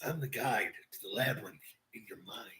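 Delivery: eerie breathy whisper; mock-mysterious whisper; creepy whisper. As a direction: whisper it like a spooky guide. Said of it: "Stop it".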